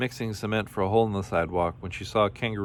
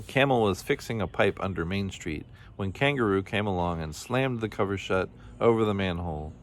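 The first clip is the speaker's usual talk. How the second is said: usual speaking voice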